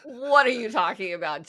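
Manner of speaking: loud, hammed-up disbelief; overwhelmed amazement. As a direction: blown away, drawing out the shock. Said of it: "I was so overwhelmed by the answer".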